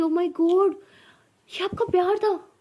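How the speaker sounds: high, loud mock shock; incredulous. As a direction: exclaim in disbelief at that so-called love. Incredulous at the supposed loving tone.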